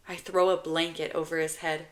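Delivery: ordinary delivery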